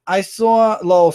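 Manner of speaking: ordinary delivery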